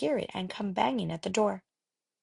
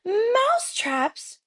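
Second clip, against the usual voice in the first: slow, high cry of many witches; the witches echoing in slow delight; crowd of witches crying; 14 semitones higher, 4 dB louder, slower than usual. A narrator's reading. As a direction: witches crying out the word back. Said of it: "cried the witches".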